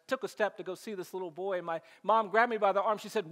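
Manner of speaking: ordinary talk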